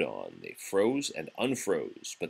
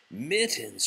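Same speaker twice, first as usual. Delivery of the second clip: shouted; 3 semitones higher than usual. Acted. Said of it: "shouted the twins".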